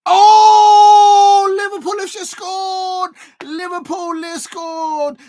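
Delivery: high, loud cry of disbelief; excited shout. Real, not acted. Fan yelling at his stream as Liverpool score. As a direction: yell it out as the goal goes in. Live reaction to a goal.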